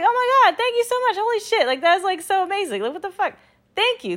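high, loud, over-the-top excited squeal; thrilled gratitude. Retold or imitated. Shopper reacts to a stranger's compliment, thrilled and squealing. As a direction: shriek your delighted thanks.

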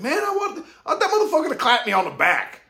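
imitated craving for praise; eager inner voice. Voicing his eager ego.

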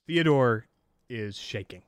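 ordinary talk

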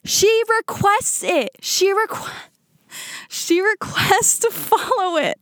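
high, drawn-out disbelief; incredulous storytelling. Retold or imitated. Telling viewers her friend dared request him, incredulous. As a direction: stretch it out in a high-pitched, scandalized voice. Shocked retelling of friend's bold move.

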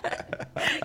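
breathy giggle; quiet laugh under breath; soft unvoiced laugh. Real, not acted. Laughing at own impression.

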